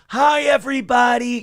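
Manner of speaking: mocking high chirpy hello; prim greeting. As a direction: mimic a polite official hello. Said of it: "Here's our new rat czar".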